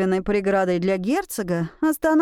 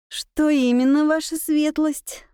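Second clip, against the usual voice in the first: politely deferential question; respectful; 6 semitones higher than usual. A narrator's reading. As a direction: ask with careful respect. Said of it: "Со всем возможным почтением".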